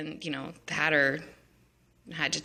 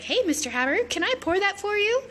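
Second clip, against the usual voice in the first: put-on much higher, eager girly offer; sweet Alice. Alice offering tea to the Hatter, high and sweet. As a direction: simper it sweetly. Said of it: "she's like".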